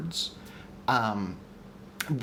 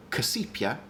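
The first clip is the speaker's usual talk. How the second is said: casipia with the end lifted in a rise; shifted stress demo. Shown as a demonstration. Host showing stress options on three syllables, casipia rising. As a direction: try casipia, letting the last syllable go up. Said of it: "So we could do".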